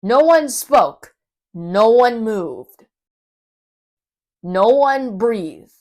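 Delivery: plain reading voice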